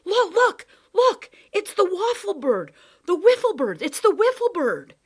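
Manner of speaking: high, loud shouting at the top of his voice; excited shout. Acted. Boy spotting a bird, yelling to the professor, loud excited shout. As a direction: call out to the professor, thrilled. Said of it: "then he shouted".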